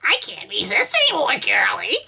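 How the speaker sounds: put-on failed old man voice, high and fast; a botched creaky old man. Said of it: "trying to do an old man voice".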